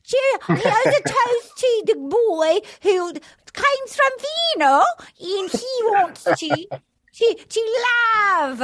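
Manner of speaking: high, drawn-out fake British accent; bad British accent. Imitating a contestant's toast, bad British accent. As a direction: ham up a terrible British toast, pitched high. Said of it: "she did a toast with a bad accent".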